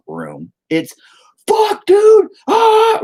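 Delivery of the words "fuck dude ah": very high, loud comic shriek; hammed-up panic, screamed at full pitch; panicked screaming. Patient bursting into emergency room, panicked high shriek.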